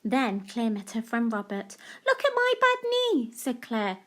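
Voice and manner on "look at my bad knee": put-on high little girl voice; high-pitched child's "look"; child showing knee. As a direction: show off the knee like an excited child. Claire's repeated line.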